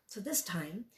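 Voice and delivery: normal talking voice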